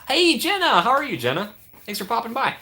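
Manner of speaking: lilting, very high welcome; high-pitched greeting. Real, not acted. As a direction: greet a friend in a high sing-song. Greets an arriving viewer.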